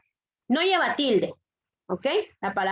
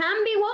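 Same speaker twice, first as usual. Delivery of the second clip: "ambiguo" with raised pitch, stressed on AM; hypothetical mispronunciation "ÁMbiguo", pitched up; esdrújula stress; 9 semitones higher than usual. Shown as a demonstration. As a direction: say it as ÁM-bi-guo, first syllable strongest. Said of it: "entonces sería esdrújula".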